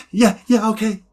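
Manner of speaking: high, hasty agreement; cowed child. Retold or imitated. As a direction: give in nervously as a kid in trouble. Scared child submits in story.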